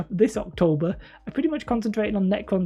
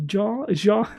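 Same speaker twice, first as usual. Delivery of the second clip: normal talking voice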